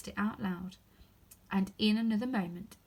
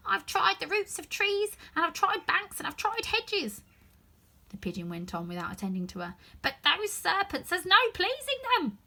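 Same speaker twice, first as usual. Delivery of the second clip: higher, louder grumbling bird; complaining pigeon; 10 semitones higher, 4 dB louder than usual. Acted. Pigeon grumbling about serpents, higher and louder. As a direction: sigh out your grievance.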